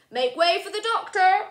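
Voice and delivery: high, loud call to clear the way. Acted. Luther clearing a path for Sophie, high and loud. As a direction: shout for the crowd to part.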